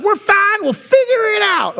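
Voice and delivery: breezy high shout of no worries; Jamie Hugerwerf impression. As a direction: toss it off like nothing could go wrong. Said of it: "that's my Jamie Hugerwerf impression".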